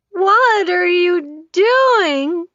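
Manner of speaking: very slow, high, slurred; drugged slurring question. A narrator's reading. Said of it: "comes out slurred".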